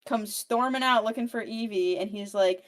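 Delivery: ordinary talk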